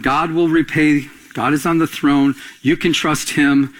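ordinary delivery

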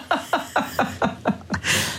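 high, breathless run of laughter; helpless giggling at your own joke; real laugh. Real, not acted. Author after joking about childhood artwork, high real laughing.

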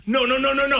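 high, urgent string of nos; mock panic, trying to stop someone; retold frantic warning. Assistant frantically waving to stop him.

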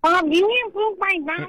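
high squeaky cartoon character voice. Real, not acted. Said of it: "Why do you talk like a cartoon character?".

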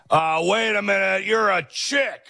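mock founders' voice. Host voicing an imagined official refusing a woman. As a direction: play an incredulous old official. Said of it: "Nobody said".

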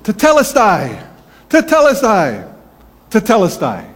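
loud, drawn-out cry falling from high pitch; imitating proclamation. Student speaker Owen proclaiming to the graduation crowd, imitated loud cry. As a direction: call it out high and slow, three times. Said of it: "He goes, ... He said it three times".

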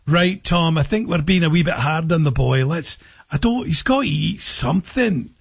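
Scottish mother softening; Scottish accent. Acted. Mother says to father they were too hard, in a Scottish accent. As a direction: plead the boy's case in a Scottish accent. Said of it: "your mother finally says to your father".

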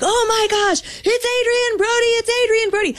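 high-pitched town-crier holler, acted out; retold excited shouting. Street hype man rallying the crowd, mock shouting. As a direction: re-enact a starstruck shout, pitch way up. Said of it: "So I start shouting like".

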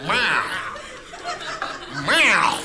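yelling cat. Acted. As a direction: yowl for food, then push harder. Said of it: "cats are yelling at you".

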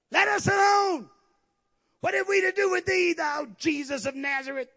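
high, loud shout of an unclean spirit; demon's shouted plea; crying out. Acted. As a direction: cry out in protest, high and loud. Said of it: "He cried out".

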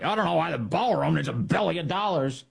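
a lightly voiced, clueless senator, mocking; mock Senate Republican. Host mocking Senate Republicans on ballroom cost, a light mock whine. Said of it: "Senate Republicans play along".

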